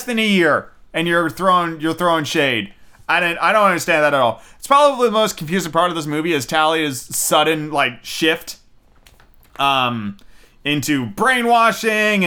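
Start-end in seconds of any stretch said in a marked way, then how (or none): none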